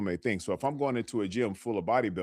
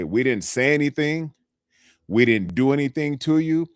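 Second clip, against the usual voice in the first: higher and louder, pressing the point; emphatic insistence; 5 semitones higher, 7 dB louder than usual. Real, not acted. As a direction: push hard so they believe you. Coach insists gym-goers mean no harm.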